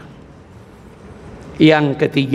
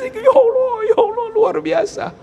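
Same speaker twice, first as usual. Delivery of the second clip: much higher tearful crying voice. Retold or imitated. Man overwhelmed at arriving on time, crying high. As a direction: sob out the thanks to God. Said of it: "ada nangis".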